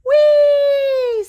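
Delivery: high, loud gleeful whee; delighted squeal. Acted. Little sister squealing at the flying machine, loud and high. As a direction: squeal with joy.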